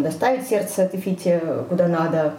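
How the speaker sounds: ordinary talk, nothing marked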